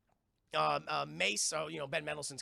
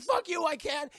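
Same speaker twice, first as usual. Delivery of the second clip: mock defiant shout; 11 semitones higher, 7 dB louder than usual. Heroine defiant in recap.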